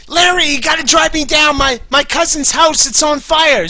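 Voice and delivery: high, loud, frantic wife; hammed-up frantic Susie voice. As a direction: shriek it like a panicked woman. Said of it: "It's like".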